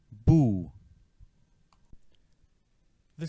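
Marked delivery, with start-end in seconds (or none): 0.1-0.7 "Boo" with a long oo; "Boo" held, teacher demo; syllable demo. Shown as a demonstration. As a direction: sound out "Boo" slowly, as a model to repeat. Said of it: "and sounds like this".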